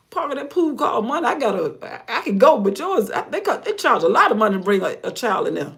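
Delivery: high and loud, laughing through it; excited retort to grandchild. Retold or imitated. Telling grandchild about pool cost.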